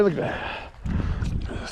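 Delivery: slow, awed whisper. Real, not acted. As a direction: breathe it out in amazement. Admiring the fish.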